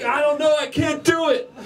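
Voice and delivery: high, whining and half laughing; whiny Luke. Retold or imitated. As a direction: whine it like a frustrated kid. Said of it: "And Luke's like".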